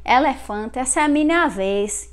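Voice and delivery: open-vowel Northeast Brazilian pronunciation; Northeast Brazilian accent. Shown as a demonstration. Teacher imitating northeasterners' speech, open-vowel Northeast Brazilian accent. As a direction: say it with wide-open e's, Northeast Brazilian style. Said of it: "in northeast of Brazil, they would say".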